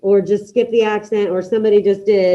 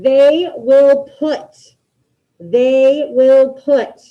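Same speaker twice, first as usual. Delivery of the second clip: very slow, louder drawn-out prompt; slow dictation; 2 semitones higher, 5 dB louder, much slower than usual. Shown as a demonstration. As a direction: stretch each word for the class. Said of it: "figure out which verb means put".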